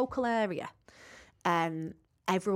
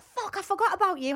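surprised; 11 semitones higher than usual. Retold or imitated. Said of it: "I was like".

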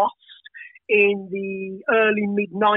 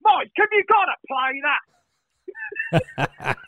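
laughing mock plea; 8 semitones higher, much slower than usual. Acted quote of complaint.

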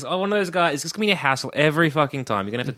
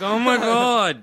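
drawn-out, exasperated oh my God; mock exasperation; 7 semitones higher, much slower than usual. Friend dreading Hawkeye's moping, drawn-out exasperated groan. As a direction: let the groan stretch out slowly. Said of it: "I'm like".

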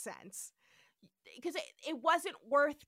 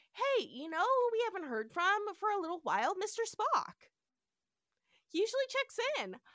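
retold puzzled captain; 7 semitones higher than usual. Acting out Kirk wondering about Spock.

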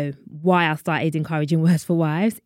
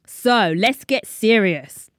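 normal talking voice

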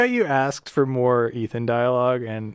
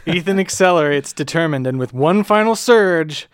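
louder, higher dramatic build; mock epic; 5 semitones higher, 6 dB louder than usual. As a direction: hype it like a trailer.

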